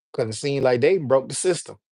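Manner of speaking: ordinary delivery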